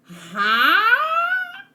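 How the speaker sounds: high cry of disbelief; shocked huh. Real, not acted. Reading the new chapter, a high shocked huh. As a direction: gasp it out in sheer shock.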